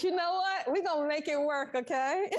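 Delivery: okay? breaking into a high laugh. Real, not acted. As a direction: laugh off the echo trouble. Laughing at the echo problem.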